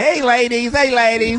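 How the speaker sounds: high put-on ladies' man voice; imitating Louis Dix. Imitating his buddy calling out to ladies, high and goofy. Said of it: "You sound just like him".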